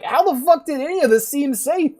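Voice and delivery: loud, high exasperated outburst; incredulous rant. Retold or imitated. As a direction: yell at her in disbelief. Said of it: "And you're like".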